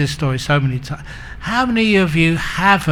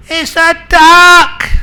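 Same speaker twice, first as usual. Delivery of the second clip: very high, very loud, drawn-out yell; furious mock shout; 14 semitones higher, 10 dB louder, slower than usual. Husband yells back at his wife, comically furious. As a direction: top the other spouse with a hammed-up bellow.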